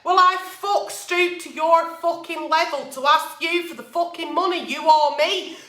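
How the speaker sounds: usual speaking voice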